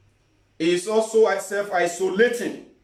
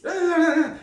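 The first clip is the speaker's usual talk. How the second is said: short high laugh; burst of laughter; 12 semitones higher than usual. Real, not acted. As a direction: laugh at your own mockery. Laughs at own parody.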